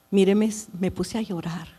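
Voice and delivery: usual speaking voice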